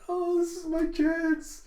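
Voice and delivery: very high, loud, drawn-out squeak; thrilled child. Acted. Anya learning she shares the boy's table, thrilled squeak. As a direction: squeal it like a delighted little girl. Child sees her big chance.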